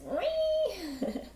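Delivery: a short high giggle breaking out. Real, not acted. Cook giggling at chat's raw potato habit, high giggle.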